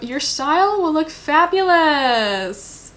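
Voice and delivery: a hammed-up, slow card reading; sing-song reading. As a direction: drawl it out like a fortune card.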